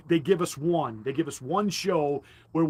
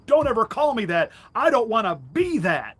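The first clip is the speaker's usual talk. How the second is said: high, loud prissy protest; mocking Romney; 7 semitones higher, 7 dB louder than usual. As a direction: squeal it like an offended politician.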